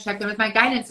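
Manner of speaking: ordinary delivery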